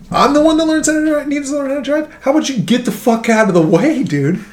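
high, whiny imitation of the rude driver; mock the guy as a squeaky jerk. Imitating the rude driver at the coffee shop, high and whiny. Other driver voiced mocking.

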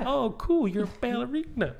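put-on high, impressed child voice; innocent little kid. Little boy to friend's dancer mom, innocently impressed. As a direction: gush it like a naive little boy. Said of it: "I'm so innocent at the time".

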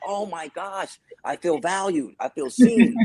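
retelling his joy at the manager's call, mock gushing. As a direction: gush with happiness. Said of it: "I'm like".

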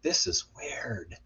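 slow, soft whisper; hushed thought. Retold or imitated. Storyteller recalls his private thought on a strange night, hushed whisper. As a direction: whisper it slowly to yourself. Retold uneasy thought to himself.

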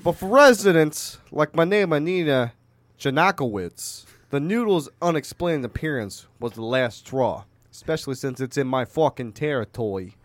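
put-on Italian-American mobster accent; Tony Soprano. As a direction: tell it like an Italian-American gangster. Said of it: "talk like a fucking Italian gangster".

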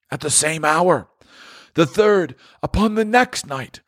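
high, solemn ghostly lament; a tormented spirit grieving over his wasted life; mournful ghost, pitched high; Marley's ghost. Acted.

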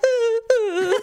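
high embarrassed ooh ooh; squirming whimper. Retold or imitated. Girl being checked in fitting room, high squirm. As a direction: squeal like a shy kid being looked at. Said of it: "I'm like".

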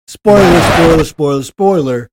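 loud repeated spoiler alert; loud warning. Real, not acted. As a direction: call out the warning.

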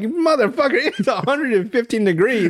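high, laughing exasperation; exasperated retort. Retold or imitated. Telling workers in long sleeves it's too hot, high exasperated retort. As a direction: throw up your hands at the heat. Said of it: "But I'm like".

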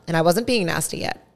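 ordinary talk, nothing marked

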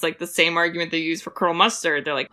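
ordinary delivery

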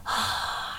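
long, soft breathy sigh; retold sigh. Reacting to mom's letter, a heavy sigh. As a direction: let out a soft, heavy exhale. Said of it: "I was just like".

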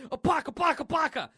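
high, loud pistol-fire noises; imitated gunfire. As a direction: spit out syllables like two guns firing. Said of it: "with his two guns".

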